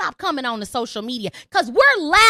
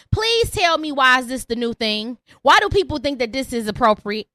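usual speaking voice

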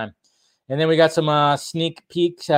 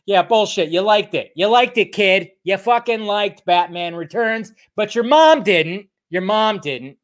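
teasing; 6 semitones higher than usual. Real, not acted. Teasing friend, mocking.

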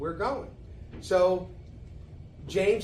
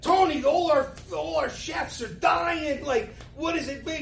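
Regis impression; 10 semitones higher than usual. Regis telling Tony his chefs are dying to meet him, high and excited. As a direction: rave like a starstruck talk show host. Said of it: "picture Regis, the way he talked".

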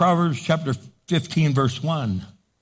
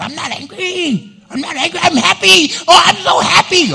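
much higher, shouting happiness; loud happy protest; 14 semitones higher, 8 dB louder than usual. Retold or imitated. Loud person mistaken for angry, shouting that they're happy. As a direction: insist on joy way too loudly. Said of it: "loud people are misunderstood".

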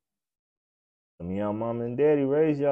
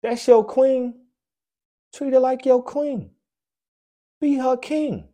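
high preachy rant; 11 semitones higher, 6 dB louder than usual. Real, not acted. Preaching to couples.

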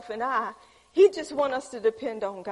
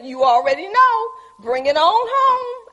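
rising to a loud, high cry on home; loud triumphant declaration, slowed on home; bold faith declaration; 7 semitones higher, 9 dB louder than usual. Pretend. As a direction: proclaim it loud to heaven, stretching home. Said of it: "just looks up and say".

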